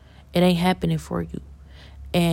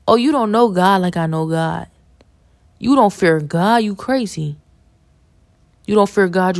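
louder, forceful rebuke; stern scolding; 5 dB louder than usual. Real, not acted. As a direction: scold them for not fearing God. Scolding people who don't fear God.

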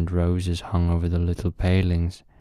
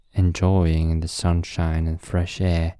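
ordinary delivery, nothing marked

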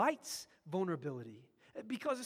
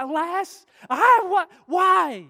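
a loud, high wail of grief, much higher and louder; anguished cry; 10 semitones higher, 21 dB louder, slower than usual. Acted. Joshua cries out to God, a loud anguished wail. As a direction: break into a desperate cry. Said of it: "He says with the cry".

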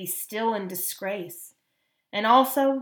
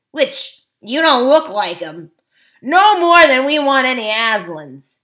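loud, blunt dwarf leader; defiant dwarf; 11 dB louder than usual. A narrator's reading. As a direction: declare independence with scorn.

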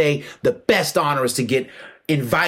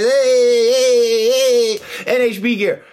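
loud, higher hype-man shout; hyped-up calling out; 8 semitones higher, 5 dB louder, much slower than usual. Retold or imitated. Old school jiu-jitsu guys hyping the forum, loud excited calling out. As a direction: shout it like a fan greeting the crowd.

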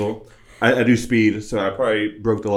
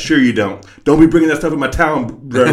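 mock cop voice; 5 semitones higher, 8 dB louder than usual. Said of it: "And they're like".

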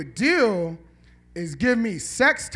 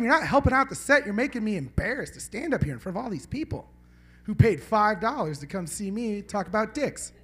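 normal talking voice

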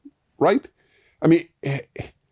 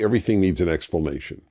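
usual speaking voice

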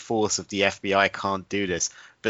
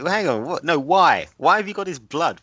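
high, baffled rapid questions; incredulous; 8 semitones higher, 4 dB louder than usual. Retold or imitated. Mocking the agent in the movie with high disbelief. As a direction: act out total disbelief.

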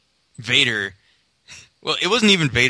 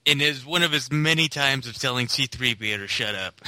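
ordinary delivery

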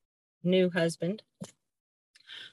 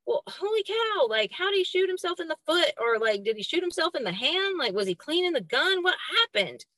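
panicked racing thoughts; 8 semitones higher, faster than usual. Retold or imitated. Panicked thoughts running to porch.

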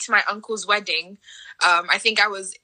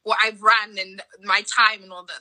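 ordinary delivery

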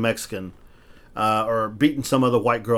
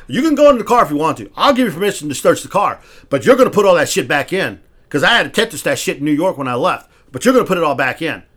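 raised, louder heated telling-off of the cop; angry retort; 5 semitones higher, 8 dB louder than usual. Retold or imitated. Retelling how he told the cop off, raised and louder. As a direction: push back hard at the officer.

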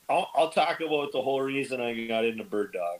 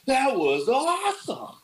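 high, slow retold cheer; excited yell; slower than usual. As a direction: relive the thrill of the shot, cheering. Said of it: "I just remember yelling".

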